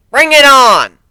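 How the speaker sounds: high, loud defiant shout; guard yells his eager acceptance of the fight; loud challenge. Acted. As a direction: throw down the gauntlet.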